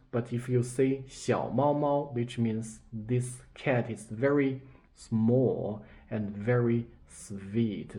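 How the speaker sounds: ordinary delivery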